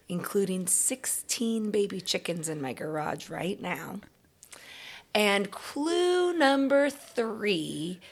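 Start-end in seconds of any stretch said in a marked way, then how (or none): 5.1-8.0 slow, teasing clue voice; slow clue reveal. Acted. Mystery reader teasing the kids with clues, slow and playful. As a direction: draw out each clue like a guessing game. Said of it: "mystery reader giving clues".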